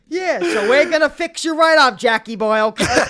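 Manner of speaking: loud, high mock-cheerful dentist; hammed-up chummy dentist reassuring a patient; upbeat dentist. Imagined sunny, upbeat dentist.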